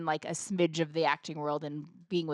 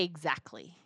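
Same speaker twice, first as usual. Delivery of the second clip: quiet mock Exactly; 4 semitones lower, 8 dB quieter, slower than usual. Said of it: "You used to say".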